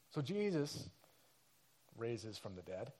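ordinary talk

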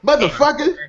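lightly imitated movie-star curse; Samuel L. Jackson. Interrupting a friend's voice pack wish, Samuel L. Jackson impression. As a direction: toss in the signature swear. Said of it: "Samuel L. Jackson voice pack".

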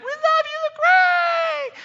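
put-on high, loud squealing teen girls; screaming fangirls. Preacher mimicking screaming junior high girls at a [name] concert, shrill squeal. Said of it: "junior high girls behind me like".

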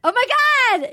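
high-pitched delighted oh my God; excited realization. Real, not acted. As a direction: burst out, thrilled by the discovery. Delighted at friend's accent attempt.

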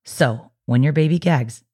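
ordinary delivery

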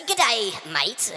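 Aussie greeting. Shown as a demonstration. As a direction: stretch out an Australian "Good day, mate".

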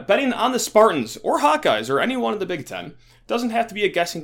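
upbeat promo read, slowing on names. Acted. Host reads a sponsor promo in an upbeat ad voice. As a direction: sell the podcast like a radio spot.

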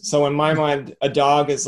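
normal talking voice

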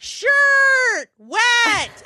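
put-on high shouted toddler demand. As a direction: bark it like a bossy two-year-old. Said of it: "toddler demands".

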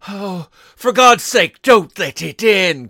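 slow, loud, terrified plea; old man crying out in dread; trembling cry. A narrator's reading. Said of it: "cried the old man, trembling".

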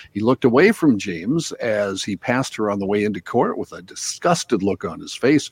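steady radio newscast delivery; news read. Acted.

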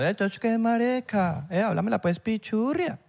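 hammed-up Colombian slang, teasing; mock Colombian accent. A friend teasing that Abelardo will sound Colombian, in a mock Colombian accent. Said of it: "van a ver hablando colombiano".

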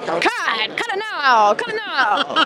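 put-on drawn-out old-timey movie director; Sam Raimi old-timey voice. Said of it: "he'd do kind of this old-timey voice".

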